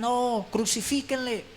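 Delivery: high, slow mock crowd chant; preacher imitating the crowd demanding crucifixion, high and slow; mock crowd cry. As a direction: drag out the demand like a mob.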